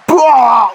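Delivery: loud disgusted spit-out. Acted. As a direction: spit it out in revulsion. Spits out the vile food.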